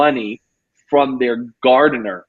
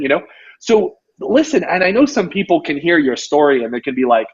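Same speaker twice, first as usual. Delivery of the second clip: normal talking voice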